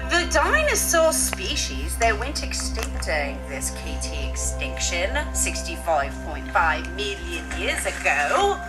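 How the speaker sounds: ordinary talk, nothing marked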